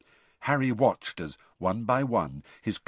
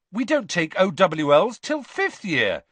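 high, loud protest; indignant; 9 semitones higher, 7 dB louder than usual. A narrator's reading. Student protesting to the professor about exams, indignant. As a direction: object as if wrongly accused. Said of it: "said Dean Thomas indignantly".

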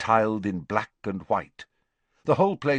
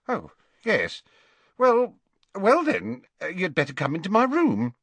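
flustered matron, higher and halting; feeble; 10 semitones higher than usual. A narrator's reading. Matron inviting a startling visitor in, feebly. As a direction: falter as if taken aback. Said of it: "she said feebly".

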